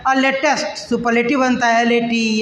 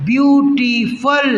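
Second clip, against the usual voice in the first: stretched word; 2 semitones higher, much slower than usual. Shown as a demonstration. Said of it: "beautiful तीन बार में बोल पा रहे हैं".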